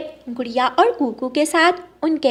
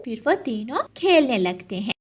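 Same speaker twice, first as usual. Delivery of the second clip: ordinary delivery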